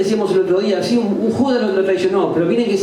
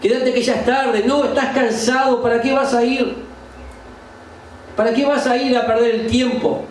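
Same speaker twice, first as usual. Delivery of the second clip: higher-pitched voice of self-excuse; acted inner naysayer, pitched up; tempting inner voice; 6 semitones higher than usual. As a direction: coax yourself into staying home. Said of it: "tu propia alma te va a decir".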